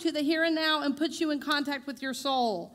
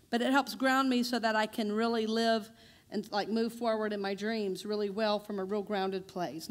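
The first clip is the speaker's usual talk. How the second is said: ordinary talk, nothing marked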